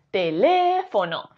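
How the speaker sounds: "teléfono" with a long, held LE; slow "teléfono" stressed on LE; stress on second syllable. Shown as a demonstration. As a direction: stretch and hit LE, te-LÉÉ-fo-no. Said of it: "Si fuese le".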